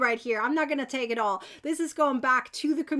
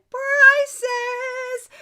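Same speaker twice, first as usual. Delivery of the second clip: excited announcement; 8 semitones higher, 6 dB louder than usual. Real, not acted. Announcing prizes.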